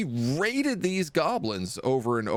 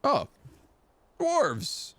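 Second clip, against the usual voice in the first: sudden high, surprised shout of discovery; excited cry; 13 semitones higher than usual. Real, not acted. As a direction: blurt it out, thrilled. Spots the dwarves in game.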